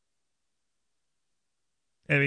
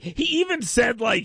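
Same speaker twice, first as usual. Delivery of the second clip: ordinary delivery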